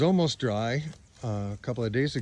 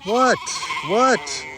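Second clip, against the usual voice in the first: high startled what; surprised; 7 semitones higher, 5 dB louder than usual. Real, not acted. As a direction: react as if insulted. Reacting to bleating.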